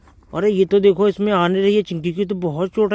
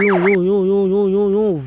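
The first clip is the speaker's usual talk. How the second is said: loud playful engine vroom; vroom noises; 4 dB louder than usual. Acted. Driving the toy police car for the kids, loud vroom. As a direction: zoom the toy car along.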